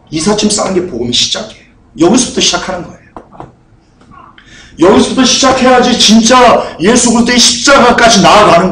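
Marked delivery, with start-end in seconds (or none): 4.8-8.7 raised-pitch sermon urgency; full-force preaching. Real, not acted. Pastor pointing the congregation toward the cross, impassioned preaching. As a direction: drive the point home from the pulpit.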